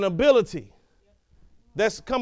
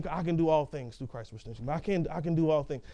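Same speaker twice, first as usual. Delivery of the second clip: self-encouraging mumble; 6 semitones lower, 8 dB quieter, faster than usual. Retold or imitated. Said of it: "trying to be the little engine".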